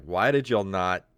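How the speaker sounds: ordinary talk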